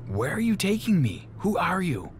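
put-on a kidnapped boy pleading with his captors in fear; frightened boy.

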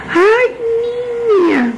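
long, very high "ay" sliding down; gushing exclamation. A narrator's reading. Woman returning to flatter the girl, gushing. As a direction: coo it high, then settle toward normal pitch.

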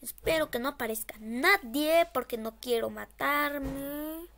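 usual speaking voice

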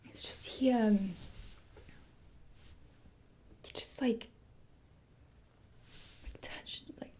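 halting, near-whispered confession; barely able to get the words out; hesitant disclosure. Acted. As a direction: trail into a whisper between long pauses. Haltingly starts a painful disclosure.

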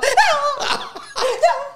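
high helpless laugh breaking out; can't hold back the laugh; burst of laughter. Real, not acted. Laughing at the memory of the praying stranger.

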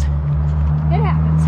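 It happens dropped very low and slow; deep slow drawl. Shown as a demonstration. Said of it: "repeated as a contrast".

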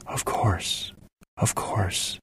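breathy hushed whisper; soft soothing whisper, barely voiced. A narrator's reading. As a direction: comfort him in a hush. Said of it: "Shanann said".

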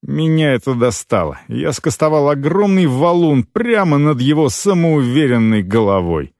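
ordinary delivery

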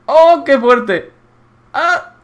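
very high, loud, stretched exclamation; amazed outburst. Real, not acted. Player amazed by the bathroom, high drawn-out astonishment. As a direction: squeal it in disbelief.

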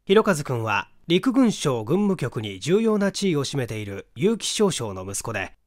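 plain reading voice

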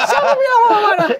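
loud, very high mock-mom retort; hammed-up motherly outrage, laughing; imitating angry mother. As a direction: protest shrilly like an offended mom. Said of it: "itu marah".

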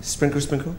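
small, high, delicate voice; dainty sprinkling. Acted. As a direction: say it lightly and softly, as if scattering drops. Acting out sprinkling water again.

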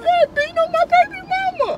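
high, hammed-up crying; tearful wail. Man grieving over his baby mama, wailing. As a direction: whine it out high through tears.

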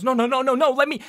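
high, loud stammered protest; stumbling back and begging; panicked Kurt. Acted. Kurt protests as he is pushed backwards. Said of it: "and goes".

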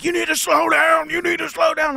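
high, loud angry order; angry vet shout. Retold or imitated. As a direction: yell at him like the raging vet. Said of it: "And he's like".

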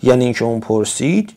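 ordinary talk, nothing marked

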